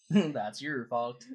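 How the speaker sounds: teasing Swedish-Canadian character voice; Credence. Acted. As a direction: draw out the blame in Swedish-Canadian. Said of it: "I'm good old Swedish Canadian".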